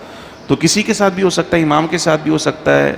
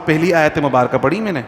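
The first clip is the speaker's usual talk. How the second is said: normal talking voice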